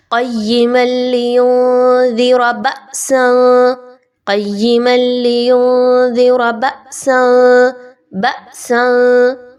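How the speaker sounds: बअसन with a sharp catch on the hamza; jerked hamza. Shown as a demonstration. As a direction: jolt the hamza in बअसन, slowly. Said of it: "झटका देकर पढ़ा जाएगा". (बअसन में हमज़ा झटके से)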